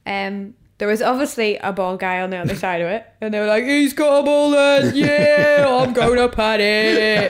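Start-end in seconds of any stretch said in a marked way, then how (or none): 3.6-7.3 put-on loud, slow, high drunken chant; hammed-up bellowing drunk London lads; drunk lads jeering. Retelling drunk guys mocking a bald passenger, loud drawn-out jeer. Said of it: "very drunk".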